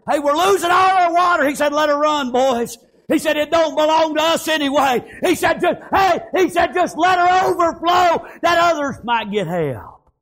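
high, driving revival preacher; high-pitched pulpit shouting; full-force preaching. Real, not acted. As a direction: preach it hard, pitched well up.

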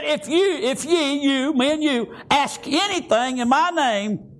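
ordinary talk

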